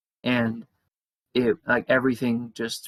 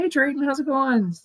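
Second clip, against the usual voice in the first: put-on high girl's voice; voicing the girl; 13 semitones higher, 3 dB louder than usual. The girl greeting Drayton, light high voice.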